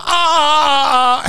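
high cry into a big laugh; whoop and laugh. Real, not acted. Cracking up at own bit.

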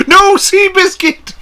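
high, slow, drawn-out wail; imitated anguished yell. Imitating a friend's yell over the horse, mock anguish. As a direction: cry out in mock despair. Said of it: "that was my favorite yell of you".

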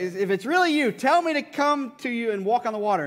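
acted bold hail; calling out. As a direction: call out to him over the water. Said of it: "Peter called out to him".

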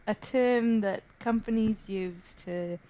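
usual speaking voice

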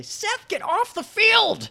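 high, slow mock coach yell; hammed-up coach shouting across the field. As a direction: bark it like an angry coach. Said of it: "Uh, the coach".

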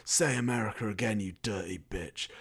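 low, hushed mock patriotic arousal; glassy-eyed lust. Film hero turned on by his country, glassy-eyed. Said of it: "he gets all glassy-eyed".

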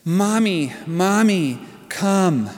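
put-on high, plaintive toddler calling from bed; little child calling. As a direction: call out like a scared two-year-old down the hall. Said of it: "from down the hall we hear".

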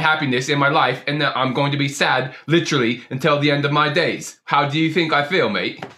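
put-on aggrieved rant in a British-Australian accent. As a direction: pour out the hurt in a broad Aussie-British accent. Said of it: "a really good accent".

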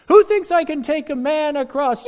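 high, loud ringmaster shout; showman's shout. Acted. Daredevil shouts his dare to the crowd, loud. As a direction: belt it to the back row.

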